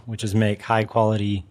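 normal talking voice

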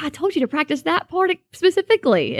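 mild mock scolding. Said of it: "like, angry with me".

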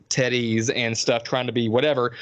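usual speaking voice

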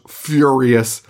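usual speaking voice

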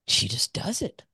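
breathy whisper of admiration; whispered awe. Real, not acted. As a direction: drop to a hushed whisper. Admiring Angelou's openness.

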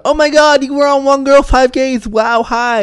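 high, loud imitation of starstruck shouting; excited fan yelling. Parade onlookers recognizing someone from a show, excited yelling. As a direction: squeal it out like fans spotting a celebrity, easing off at the end. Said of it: "people were seeing me and yelling out".

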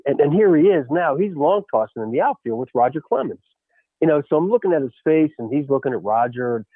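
normal talking voice

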